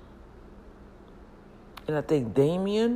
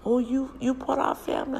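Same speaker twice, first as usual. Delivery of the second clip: pitched way up in surprise; delighted disbelief, pitched high; surprised question; 10 semitones higher than usual. Retold or imitated. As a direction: gasp out the question, amazed. Cousins surprised at her.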